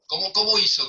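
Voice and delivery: ordinary talk, nothing marked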